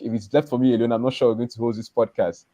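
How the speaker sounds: usual speaking voice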